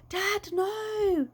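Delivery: a desperate plea; mild yell. A narrator's reading. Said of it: "yelled Lizzie".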